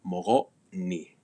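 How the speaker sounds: Mogo, a catch, then ni; "Mogo, ni" broken by a glottal stop; glottal stop, release. Shown as a demonstration. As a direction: cut off Mogo, pause, release into ni. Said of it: "you release it into that final syllable".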